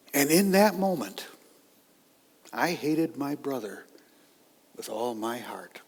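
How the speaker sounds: ordinary talk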